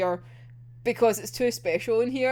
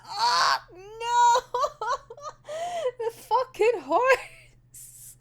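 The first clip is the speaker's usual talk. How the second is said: high, crying no melting into laughter; laughing sob; 12 semitones higher, much slower than usual. Real, not acted. Fan crying over Oda's goodbye, laughing sob. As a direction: wail it like your heart just broke.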